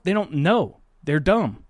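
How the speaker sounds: ordinary talk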